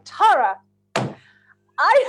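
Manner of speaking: loud call of the name, breaking off; emphatic pleading. Retold or imitated. Friend trying to get through to her, retold emphatic plea.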